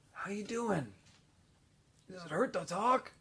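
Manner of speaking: soft, dry-throated question; softly voiced parched boy; weak, parched voice. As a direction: barely get the words out. Stanley speaking weakly, parched.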